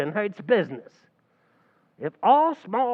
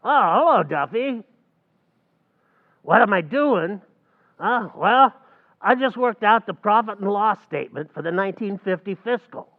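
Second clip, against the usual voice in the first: ordinary talk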